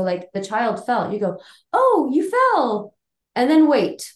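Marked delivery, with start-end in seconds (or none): 1.7-2.9 slow, high and gentle, just noting it; calm narrating demo. Shown as a demonstration. Parent to a child who fell, slow calm narrating. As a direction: state it kindly without alarm. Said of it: "very important about narrating".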